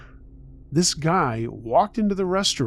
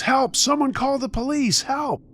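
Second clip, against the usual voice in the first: screaming for help; 13 semitones higher than usual. A narrator's reading. Woman at her window calling the neighborhood, screaming for help. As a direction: cry out for help in a much higher voice. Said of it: "started screaming at the top of my lungs".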